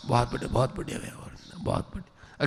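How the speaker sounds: hushed, breathy parent praise; soft doting praise. Retold or imitated. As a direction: coo gently to a small child. Parent praising lisping child.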